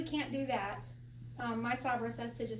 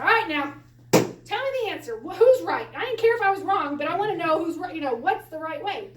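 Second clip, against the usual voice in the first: insistent plea; 4 semitones higher, 10 dB louder than usual. Retold or imitated. Demanding answer from teacher.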